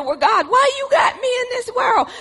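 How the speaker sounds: high shouted plea; cry to God. Acted. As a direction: throw the question up at God.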